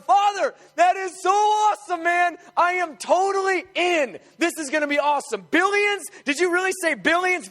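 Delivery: a son bursting with excitement at the plan; excited Son. Acted. Son hears the Father's plan, excited shouting. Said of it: "but the Son going".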